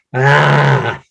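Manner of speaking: roar attempt. Shown as a demonstration. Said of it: "try to roar".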